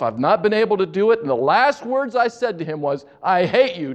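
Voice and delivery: a daughter pouring out her regret, voice lifted high; tearful pleading. Retold or imitated. Daughter confessing to the nurse on the phone, high tearful pleading. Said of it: "began to cry on the phone and sob".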